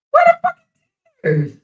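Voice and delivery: shrieked high and loud in disbelief; sudden high incredulous cry; stunned outburst. Real, not acted. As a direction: blurt it out, not believing your eyes. Reacting to fake crying video.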